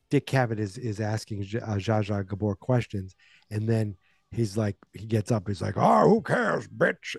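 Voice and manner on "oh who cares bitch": loud, high-pitched villain taunt; loud, mocking monster outburst; Freddy Krueger. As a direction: burst out as a movie killer, pitched up. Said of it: "as Freddy Krueger".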